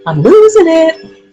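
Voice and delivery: laughing. Real, not acted. Chuckling at her own joke, laughing. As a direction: laugh it out at yourself.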